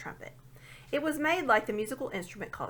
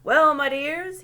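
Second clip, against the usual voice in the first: put-on booming, raised old dwarf voice; a deaf old dwarf shouting his grievance; shouting old dwarf who can't hear; loud old dwarf; 7 semitones higher, 12 dB louder, slower than usual. A narrator's reading.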